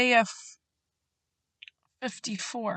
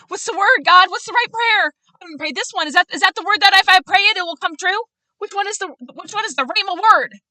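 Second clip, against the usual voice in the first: higher, louder, fast frantic questioning; mock a panicked pray-er grasping for the right words; frantic anxious prayer; 11 semitones higher, 9 dB louder than usual. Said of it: "to feel like".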